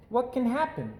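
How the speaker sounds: high, bright little cub voice, greeting everyone; cheery little girl. Acted. Little Sister greeting every passerby, cheery high girl voice. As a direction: sing out hello to every passerby.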